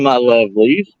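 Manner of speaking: normal talking voice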